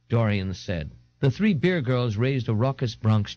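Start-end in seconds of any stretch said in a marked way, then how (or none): none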